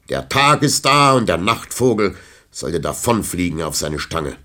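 a hint of an indignant old knight; measured, cold scolding; old knight showing the thief the door; stern rebuke. A narrator's reading.

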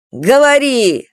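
sharp angry bark; barked order. Acted. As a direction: bark it at the boy. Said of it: "рявкнул дядя".

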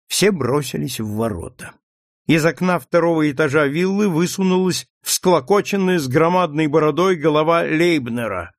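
usual speaking voice

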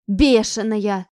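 медленный громкий выкрик; крикни вслед убегающей. A narrator's reading. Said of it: "выкрикнула ей вслед". (Кузнецова кричит вслед Ане)

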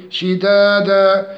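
slow شدادا with two-beat long vowels; chanted شدادا; natural madd. Shown as a demonstration. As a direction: hold both long a sounds in شدادا. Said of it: "مع المد الطبيعي".

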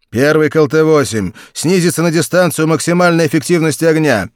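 loud, high order; radio command. A narrator's reading.

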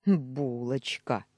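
slow affectionate purr; fond purr. A narrator's reading. Dan purrs a slow pet name after his wife. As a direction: savour the pet name slowly. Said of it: "проурчал Даня".

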